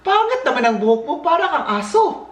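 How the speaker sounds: high mocking tease; teasing sister. Sister mocking his hair, high and teasing. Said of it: "sabi niya".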